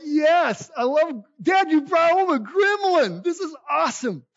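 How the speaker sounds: high, loud, overjoyed shout of delight; thrilled kid. Retold or imitated. Teen shouting to dad about the Gremlin, overjoyed. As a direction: squeal it like a kid who just got his dream car.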